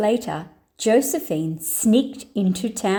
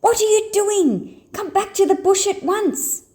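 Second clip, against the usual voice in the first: put-on bossy kid brother; 10 semitones higher than usual. Joey scolds from window.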